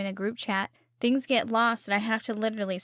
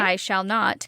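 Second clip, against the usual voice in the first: normal talking voice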